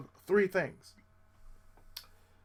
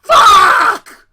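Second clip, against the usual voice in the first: much higher, loud yell; frustrated shout; 25 dB louder than usual. Retold or imitated. Tenor hearing another soprano joined, furious shout. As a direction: explode in frustration.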